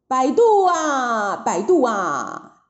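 long-drawn cry, falling away; boatman's call. A narrator's reading. Boatman advertises his ferry from shore, drawn-out calling. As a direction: call out slowly like a ferryman touting. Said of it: "他嘴里面叫着".

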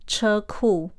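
normal talking voice

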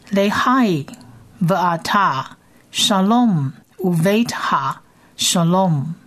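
each word separated, slow and deliberate; slow word by word reading. Shown as a demonstration. Teacher repeats verse for listeners, slow word by word. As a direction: spell it out word by word. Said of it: "我们先来念一遍".

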